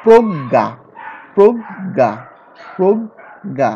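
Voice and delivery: প্রজ্ঞা said as prog-ga with a doubled g; pronunciation demo. Shown as a demonstration. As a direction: repeat প্রজ্ঞা holding the double g sound. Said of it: "এখানে দেখো দুইটা গ আসছে".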